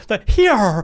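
loud, high mock old English accent; bad period accent. Co-host echoing the actress's odd word, bad period accent. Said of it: "not good old English timey accents".

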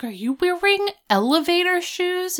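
high, incredulous question; mock shocked. Rachel noticing Jack's platform shoes, high and incredulous. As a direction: ask it in disbelief, voice jumping up.

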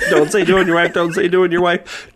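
laughing. Pretend. Joke quote repeated while laughing.

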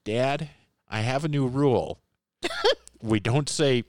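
hint of a four-year-old laying down the law; cherubic child voice. Four-year-old son in the back seat announces a rule, lightly childlike. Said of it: "this little cherubic voice from the back seat go".

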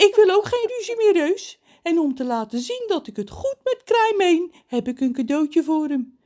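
high, loud, animated character voice; story character John. A narrator's reading. John offers the crow a present, high and loud. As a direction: speak up high and lively.

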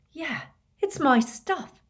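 high, slow indignant agreement; indignant realization. A narrator's reading. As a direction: lean on it, newly affronted. Harry realises it is his property.

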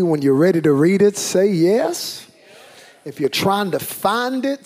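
rally the room to answer; calling out. Real, not acted. Pastor urges congregation to say yes, loudly.

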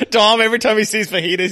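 normal talking voice

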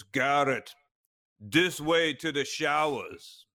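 put-on slow, lightly German-accented announcement; a hint of a German guide pointing the way. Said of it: "in that accent".

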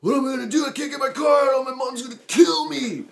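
high, loud panicked shouting; acted freak-out. Stuck driver to his friends about his car, hammed-up freak-out. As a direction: wail it like a kid in huge trouble. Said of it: "he starts to start freaking out".

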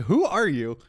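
high, loud mock incredulity; retold bafflement. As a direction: act out being utterly baffled by someone. Retells baffled reply to girl.

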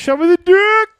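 loud high call at the screen. Real, not acted. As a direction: yell it at the stranger. Calling to stranger on video chat.

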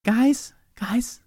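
urgent whisper. Acted. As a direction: hush it, a hidden whisper. Hushing friends inside hidden container.

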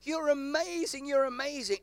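lightly voiced mum gushing over a good grade. Pastor imitating a mother gushing over her child's grade. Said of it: "A woman can gush".

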